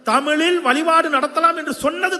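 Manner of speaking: high, loud rally proclamation; full-force political oratory. Real, not acted. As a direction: ring out each achievement to the crowd. (உச்சக் குரலில் அரசியல் மேடை முழக்கம்)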